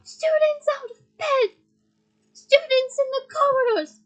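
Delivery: high, loud, slow bellow; shouting. Acted. Filch alarms the castle, shouting. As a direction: yell it down the corridor.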